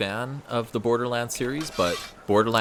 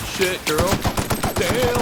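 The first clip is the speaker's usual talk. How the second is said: dismayed exclamation; 12 semitones higher, 4 dB louder, much slower than usual. Real, not acted. Teammate goes down.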